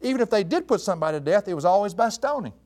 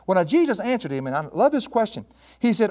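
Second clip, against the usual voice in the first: ordinary talk, nothing marked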